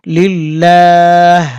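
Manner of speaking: লিল্লাহ drawn out very slowly, final h breathed from the throat; h sound voiced. Shown as a demonstration. Teacher drawing out লিল্লাহ slowly for students. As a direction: stretch লিল্লাহ and let the h come from the throat. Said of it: "এভাবে হাও আসবে".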